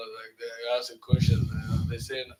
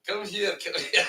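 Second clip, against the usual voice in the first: slow, higher-pitched call with laughter; official summoning; 6 semitones higher than usual. Acted. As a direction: beckon slyly, amused by the retelling. Acted officer calling him back.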